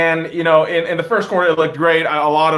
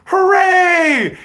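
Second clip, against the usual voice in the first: mock cheer; 7 dB louder than usual. Sarcastic cheer over ugly win.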